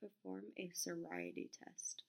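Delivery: usual speaking voice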